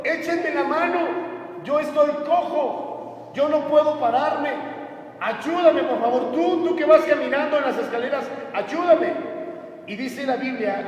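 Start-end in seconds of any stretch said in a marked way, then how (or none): 0.0-9.1 higher-pitched acted plea for help; pleading lame beggar. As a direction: beg passers-by for a hand. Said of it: "les estaba diciendo".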